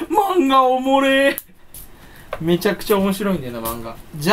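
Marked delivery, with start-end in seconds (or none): 0.1-1.3 exaggerated delight. 漫画の感想を誇張.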